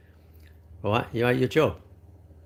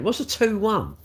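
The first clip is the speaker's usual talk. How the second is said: usual speaking voice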